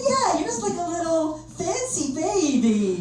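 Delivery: put-on high, drawn-out baby-talk coo; cooing American broker. Broker to the comedian, cooing in mock baby talk. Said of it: "She said".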